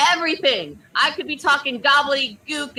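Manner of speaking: usual speaking voice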